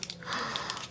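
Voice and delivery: soft breathy gasp of awe; whispered gasp. Real, not acted. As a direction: gasp quietly in wonder. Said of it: "I'm gonna be gasping".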